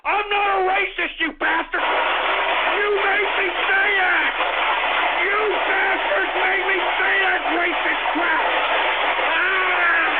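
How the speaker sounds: loud, high furious yelling; enraged outburst. Real, not acted. Host lashing out at prank callers, enraged yelling. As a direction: yell in outrage, dragging out the accusations.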